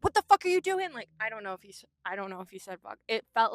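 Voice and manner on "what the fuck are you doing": high, loud imitation of an angry man; retold confrontation, pitched up and forceful; aggressive yell. Imitating donation worker who ran at her, aggressive. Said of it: "aggressively ran at me and was like".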